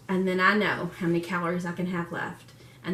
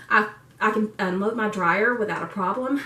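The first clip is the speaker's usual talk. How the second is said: ordinary talk, nothing marked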